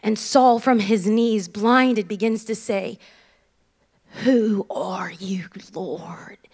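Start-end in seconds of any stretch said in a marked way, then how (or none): 4.2-6.4 slow, low southern drawl; hushed southern accent, stretched out; soft, slow southern-accented question. Acted. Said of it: "in a southern accent".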